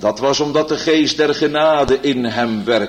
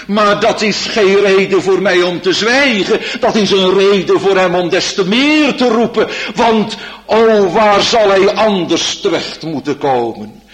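loud, high pleading preaching; fervent preaching; 8 semitones higher, 5 dB louder than usual. Acted. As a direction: build to an urgent plea. Preaching with rising fervour. (vurig en luid preken)

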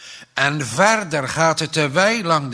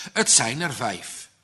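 ordinary delivery, nothing marked

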